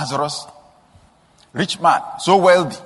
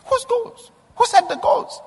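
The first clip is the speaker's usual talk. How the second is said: much higher Whose goals, pressing the question; asked again much higher. Real, not acted. Preacher pressing the congregation, much higher pitch. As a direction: throw the question up high. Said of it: "repeated higher".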